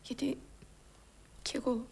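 low, very soft, halting plea; hushed sorrow. Acted. Mourning woman begs the man to stop pitying her, hushed. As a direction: wave off the pity almost in a whisper. Acted grief, woman declines the sympathy.

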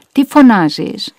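loud, drawn-out irritated demand; exasperated scolding. A narrator's reading. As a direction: scold an old mother for calling out. Relative scolds the calling mother. (εκνευρισμένη, δυνατή επίπληξη)

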